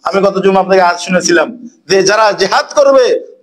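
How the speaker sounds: ordinary talk, nothing marked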